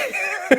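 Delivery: sudden high-pitched laugh; a real laugh that jumps up high; high burst of laughter. Real, not acted. Laughs at bleak ending.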